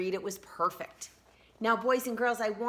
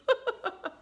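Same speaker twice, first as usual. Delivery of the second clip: put-on high-pitched childish giggle; a squeal of kid laughter. A narrator's reading.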